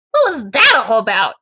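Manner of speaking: loud, pointed challenge from Kate; demanding. A narrator's reading. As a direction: demand an answer from a friend. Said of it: "Catherine demands".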